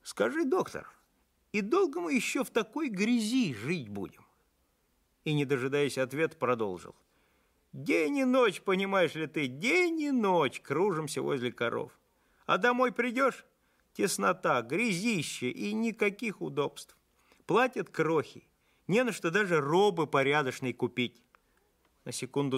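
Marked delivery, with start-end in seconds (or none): 0.0-4.2 high-pitched, needling demand; disdainful challenge. A narrator's reading. Farmhand challenges the visiting doctor, disdainful and high. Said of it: "пренебрежительно посмотрел на меня". 7.7-21.2 tense outburst. A narrator's reading. Overworked farmhand complains to the doctor, tense outburst. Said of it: "нервно дососав дымок из сигаретки".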